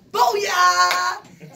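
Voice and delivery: mock shout. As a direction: belt it out like a pregame rally cry. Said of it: "His pregame talk was like".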